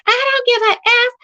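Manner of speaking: high-pitched mock defiance; imitating mad man. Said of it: "because he was mad".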